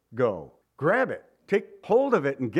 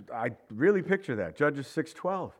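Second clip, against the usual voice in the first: ordinary talk, nothing marked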